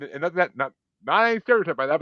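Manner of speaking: usual speaking voice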